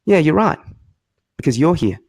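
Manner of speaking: mock-agreeing, then the jab; retold retort. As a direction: deliver a cutting comeback in two beats. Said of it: "he turned around and he goes".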